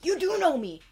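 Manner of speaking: overjoyed unicorn, high and loud; excited delight. Acted. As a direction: squeal it with joy. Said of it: "she's like".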